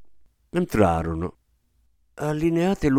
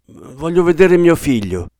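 higher, louder plea; insistent demand; 6 semitones higher, 6 dB louder than usual. A narrator's reading. Father asking nurse to see his son, insistent. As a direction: press anxiously to be let in.

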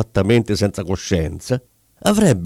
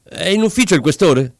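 shouted question; 6 semitones higher than usual. A narrator's reading. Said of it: "gridò mentre entrava".

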